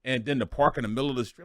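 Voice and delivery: normal talking voice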